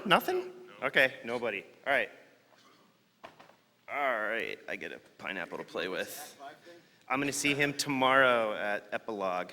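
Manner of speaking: ordinary talk, nothing marked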